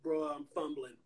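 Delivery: sheepish admission. Retold or imitated. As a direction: own up quietly to blowing it. Confessing he's fumbling.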